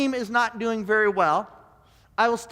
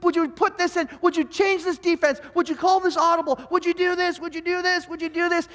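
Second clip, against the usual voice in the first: higher, pleading fan yelling at the TV; mock fan yelling; 7 semitones higher than usual. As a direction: nag the screen, repeating the same demand. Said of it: "yell things at the".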